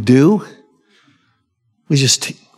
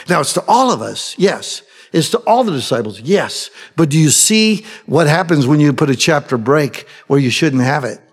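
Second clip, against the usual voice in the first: ordinary talk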